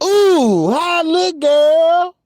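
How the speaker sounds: high, loud and gushing; 假装兴奋地尖声问; mock girly squeal. Admiring herself, high and loud.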